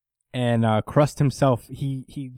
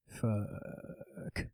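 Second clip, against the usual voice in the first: whispered dread; 15 dB quieter than usual. Retold or imitated. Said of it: "looking at Shigaraki awake like".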